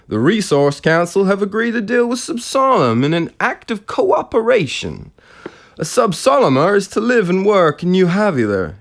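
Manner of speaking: high-pitched, bad American radio announcer; relentlessly jovial. Radio presenter announcing a council deal, jovial bad American accent. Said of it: "continued in his relentlessly jovial way".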